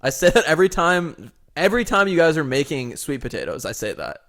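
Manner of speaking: ordinary talk, nothing marked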